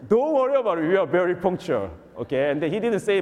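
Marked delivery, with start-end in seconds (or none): 0.1-1.9 quoting the bishop, pitched up and louder; hammed-up bishop saying don't worry; bishop's reassurance, higher and louder. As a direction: play the bishop brushing it off, voice raised higher. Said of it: "He said, he said, just".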